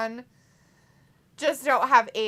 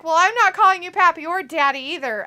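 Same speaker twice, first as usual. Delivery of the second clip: mock indignant; 6 semitones higher, 10 dB louder than usual. Acts out chatter's refusal.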